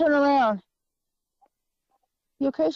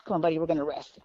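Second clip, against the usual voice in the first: soothing; 9 semitones lower, 6 dB quieter, faster than usual. Real, not acted. Soothing the tired horse.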